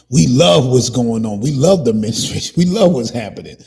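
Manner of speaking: played-up fake approval, pushed loud; mock enthusiasm. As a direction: gush it mockingly, with a laugh. Mimicking what they may think.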